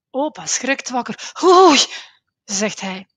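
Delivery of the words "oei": hoge, luide schrikreactie; geschrokken oei. A narrator's reading. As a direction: schrik wakker. Opa schrikt wakker. (opa schrikt wakker in de kast)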